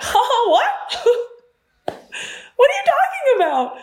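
very high squeaky disbelief; a high, stunned what; disbelieving what. Retold or imitated. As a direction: squeal it in total confusion. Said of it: "it's me kind of be like".

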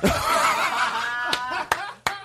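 high, long burst of laughter. Real, not acted. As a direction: laugh it out, breathless. Big laugh after the dance.

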